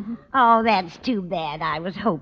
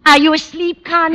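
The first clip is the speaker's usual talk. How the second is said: calling out; 9 semitones higher, 7 dB louder than usual. Acted. Landlady calling to sleeping Connie.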